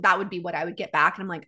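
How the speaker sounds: usual speaking voice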